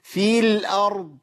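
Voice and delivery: في الأرض with the ee of في wrongly drawn out; wrong lengthened vowel. Shown as a demonstration. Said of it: "ما ينفعش أقول إيه؟".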